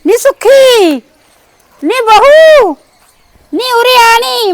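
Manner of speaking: high drawn-out call across the yard. Acted. Bhua calls out to Sukhi. (ਦੂਰੋਂ ਉੱਚੀ ਹਾਕ ਮਾਰਨਾ)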